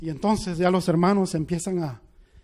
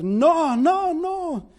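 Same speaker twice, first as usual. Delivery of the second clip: retold desperate cry; 6 semitones higher, slower than usual. Empieza ella a gritar.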